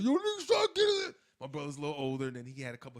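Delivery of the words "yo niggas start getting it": very high, loud call; retold shout. Kid calling his brother to go get them, loud high. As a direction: yell it for backup. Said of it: "I remember telling my brother".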